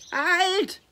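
loud high yell to stop; shouting. A narrator's reading. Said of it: "schreit Mia".

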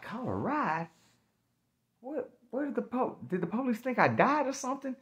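acted high-voiced amazement; incredulous. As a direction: question it in baffled disbelief. Acts disbelief at being released.

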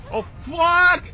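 loud, high-pitched yelp of shock; startled shout. Real, not acted. Gamer nearly killing himself in the game, startled shout. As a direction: shout the curse in sudden fright. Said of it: "grito por nada".

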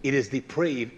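ordinary delivery

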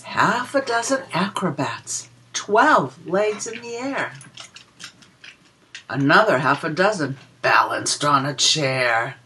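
put-on lilting read-aloud for small children; a sing-song rhyme for little listeners; reading a picture book rhyme. Reads rhyming counting book verse.

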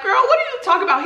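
put-on fast, high incredulous girl; disbelief. Answering Stacy on FaceTime, high fast disbelief.

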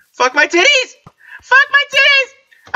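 high squeal building to a drawn-out shriek; panicked swearing. Real, not acted. Creeper close by.

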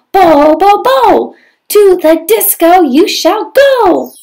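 high, loud chanted spell with slow rhyming lines; fairy spell. Acted. Fairy chanting magic words over Prince Cinders, high and loud. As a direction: cast the spell like a bungling fairy, big and loud.

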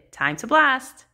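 loud, high call to blast off; shouted. A narrator's reading. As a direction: shout it out to the crew. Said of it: "she shouted".

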